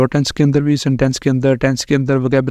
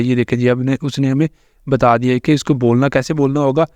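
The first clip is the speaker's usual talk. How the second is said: ordinary delivery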